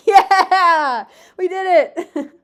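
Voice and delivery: loud, high triumphant yell with laugh; real victory shriek; excited cheer. Real, not acted. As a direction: celebrate at full volume. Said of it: "I got excited".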